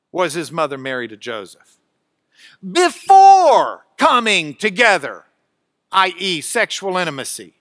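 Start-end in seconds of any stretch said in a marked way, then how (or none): 2.7-5.3 "Before" very loud, second syllable stretched, then slow; emphatic opening word. Shown as a demonstration. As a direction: hammer "Before", then measured "coming together". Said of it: "Greek word order for emphasis".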